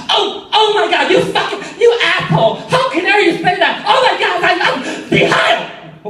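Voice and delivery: high-pitched hammed-up dictator rant; possessed dictator. Waitress raging at a customer, mock possessed dictator. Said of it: "full-on possessed dictator".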